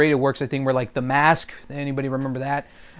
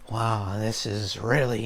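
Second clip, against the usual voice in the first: very low, slow, quieter villain voice; Heath Ledger's Joker; 5 semitones lower, 5 dB quieter, slower than usual. Acted. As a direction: drop low and take it slowly. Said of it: "when it comes to the Heath Ledger thing".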